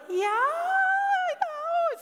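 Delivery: very high, stretched-out mock child reply; deer in headlights. Nine-year-old answering a stranger, stunned squeak. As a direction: squeak it out slowly, imitating a stunned kid. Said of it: "my nine-year-old is like deer in headlights".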